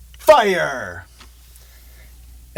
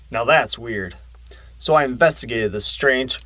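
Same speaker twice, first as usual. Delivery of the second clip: plain reading voice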